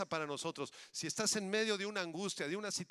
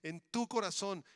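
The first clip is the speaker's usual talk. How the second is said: ordinary talk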